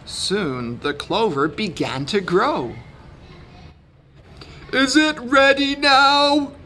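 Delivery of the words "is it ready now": loud-ish, high impatient question; impatient donkey. A narrator's reading. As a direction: ask eagerly, pitched way up, as an impatient donkey. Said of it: "asked the impatient donkey".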